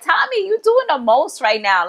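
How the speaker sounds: high-pitched, exasperated scolding; exasperated telling-off. Retold or imitated. Viewer scolds Tommy for doing the most, exasperated. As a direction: call him out, pitch jumping up.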